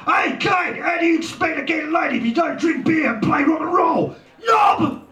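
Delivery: very high, full-force yell; jeering shout. A narrator's reading. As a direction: holler mockingly after your son, building to the last word. Father yells after son.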